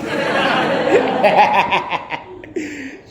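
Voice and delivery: long, high, helpless laugh; real laughter at his own bit; high cackling laugh. Real, not acted. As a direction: crack up at your own joke.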